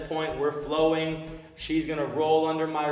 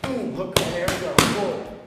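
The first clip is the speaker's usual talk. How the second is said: calling combos; 2 semitones higher, 5 dB louder than usual. Shown as a demonstration. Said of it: "any cues that I have, you guys are gonna hear".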